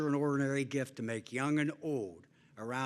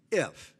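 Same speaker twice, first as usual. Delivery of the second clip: if punched and held, louder, then a pause; stress on if; 2 semitones higher, 6 dB louder than usual. Real, not acted. Speaker warning parents and coaches at the banquet, stressing if. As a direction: lean hard on the word if as a warning. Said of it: "and I emphasize the word if".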